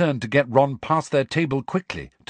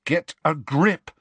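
stern; 7 semitones higher, 3 dB louder, slower than usual. A narrator's reading. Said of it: "said Harry sternly".

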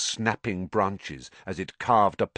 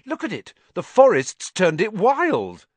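higher, thrilled; delighted; 11 semitones higher, 7 dB louder than usual. A narrator's reading. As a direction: exclaim with delighted wonder. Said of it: "said Ron delightedly".

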